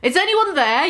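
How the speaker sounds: high calling-out "Is anyone there?"; mild mock yell. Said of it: "yelled Mister Norris".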